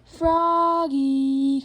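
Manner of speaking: loud, long stretched-out call; sustained storybook holler; dad calling. Acted. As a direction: call the name through the house. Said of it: "called Dad".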